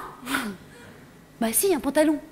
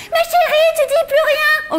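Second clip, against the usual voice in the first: high, slow, fussing mum voice; sing-song mother; 9 semitones higher, slower than usual. Retold or imitated. Mother nags her silent daughter, high and drawn out.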